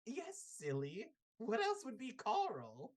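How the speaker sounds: put-on high, fussy old woman's voice; old lady. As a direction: tease the child about her cat.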